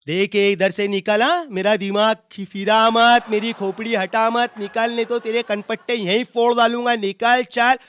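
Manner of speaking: loud Mumbai tough-guy threat; street quarrel threat. Retold or imitated. One friend threatens the other in a Mumbai fight, loud. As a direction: bark it like a Mumbai street brawler.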